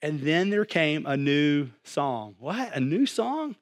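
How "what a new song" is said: small astonished double take; acted surprise. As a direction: lift the pitch in mock amazement. Acts surprise at new song.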